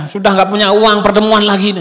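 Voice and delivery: loud, high retold telling-off; wife's angry scolding. Wife berating husband over meetings, retold angrily. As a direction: imitate a fed-up wife scolding. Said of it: "Saya digituin, dimarah-marah".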